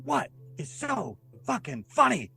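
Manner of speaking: high, drawn-out exasperated quote; exasperated retold anger. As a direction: mimic a fed-up woman, word by slow word. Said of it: "She's so pissed".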